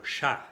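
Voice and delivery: teacher stressing "chat" in French. Shown as a demonstration. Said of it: "en francés el sonido es chat".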